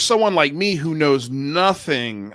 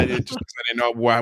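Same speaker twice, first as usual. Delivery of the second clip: normal talking voice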